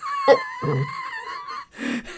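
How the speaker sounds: very high, wheezy burst of laughter; real squealing laugh; high laughing fit. Real, not acted. Laughs at learning Dutch remark.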